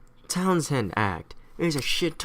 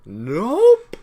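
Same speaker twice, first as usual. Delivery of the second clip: high, loud, rising comic denial; evasive neutralist; 9 semitones higher, 8 dB louder than usual. Acted. Neutralist answering Sons of Liberty, high comic denial. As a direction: swoop way up on the one word. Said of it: "the neutralists like".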